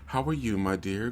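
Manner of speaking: a mild take on a calm, stiff aristocrat; understated posh drawing-room politeness; monotone TV Brit. Retold or imitated. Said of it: "vocals are always very calm, very monotone".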